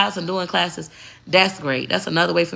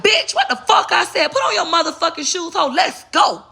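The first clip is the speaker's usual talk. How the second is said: cussing mama; 11 semitones higher than usual. Retold or imitated. Mama yelling at her kid to put on shoes, mock angry yell. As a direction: bark it like a fed-up mama hurrying her kid.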